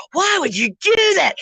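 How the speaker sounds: high-pitched mock wail of disbelief; exasperated son. Son baffled by a parent's random chess moves, exasperated. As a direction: act out a kid's outraged protest.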